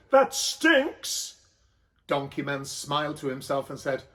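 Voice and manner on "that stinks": much higher, disgusted whine. Acted. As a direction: gag at the stink. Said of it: "said Joseph".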